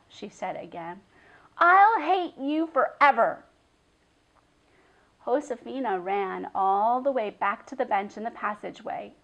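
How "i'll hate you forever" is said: loud, high vow of lasting hate; angry vow. A narrator's reading. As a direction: swear it with wounded fury.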